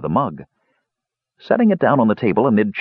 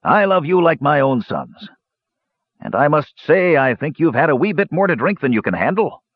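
put-on higher, hearty old dwarf; 6 semitones higher than usual. A narrator's reading. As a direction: boom it like a jolly dwarf host. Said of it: "Dugan's voice".

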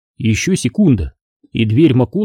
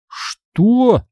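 high, startled question; shocked cry; 10 semitones higher than usual. A narrator's reading. Boy hears the deer is gone too, shocked cry. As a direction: cry out in dismay. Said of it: "вскрикнул я".

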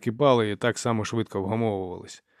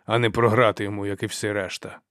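usual speaking voice

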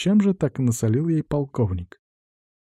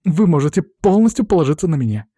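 eager, earnest promise; passionate assurance; 6 semitones higher, 4 dB louder than usual. A narrator's reading. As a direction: assure him with fervour. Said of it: "страстно заверила графа Амелия". (страстно, горячо)

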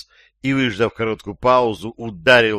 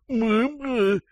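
high muffled "my-my"; gagged grunt; 13 semitones higher than usual. A narrator's reading. Gagged captive nods to captor, high muffled grunts. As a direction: hum a frantic yes through a gag. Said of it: "закивал Шустрый".

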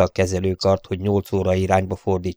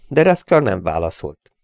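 plain reading voice

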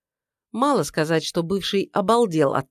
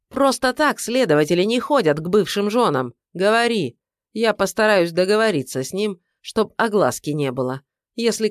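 angry tirade; 3 semitones higher than usual. Acted. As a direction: lay into him furiously.

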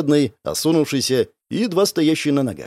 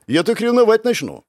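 higher-pitched mock protest; indignant; 8 semitones higher than usual. A narrator's reading. Narrator claiming jealousy to his friends, indignant. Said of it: "возмутился я".

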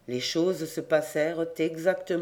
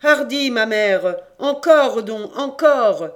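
loud, higher urging; crying out; 5 semitones higher, 10 dB louder, slower than usual. A narrator's reading. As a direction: spur her on at full voice. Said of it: "mais toujours Noélique criait". (encouragement crié, fort et aigu)

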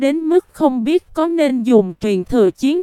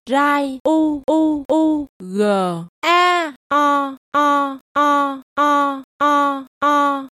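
slow, drawn-out howl of pain; a wounded beast wailing; anguished tiger roar; 2 semitones higher, slower than usual. A narrator's reading. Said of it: "Hổ vàng không ngừng phát ra tiếng kêu thảm thiết".

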